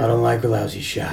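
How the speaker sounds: low, softened gravelly movie-cop imitation; Cobra, gravelly. Cop taunting gunman over the mic, gravelly. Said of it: "He's so gravelly here".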